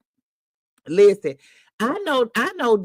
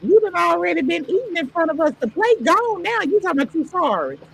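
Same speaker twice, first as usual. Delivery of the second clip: mock outrage; 9 semitones higher, faster than usual. Real, not acted. Teasing him for eating on stream.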